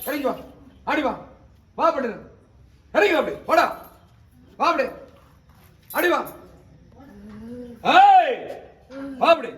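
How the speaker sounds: insistent, high-pitched ritual command; commanding summons. Real, not acted. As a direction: call the spirit out forcefully. Exorcist summons the next spirit.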